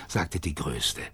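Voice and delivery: plain reading voice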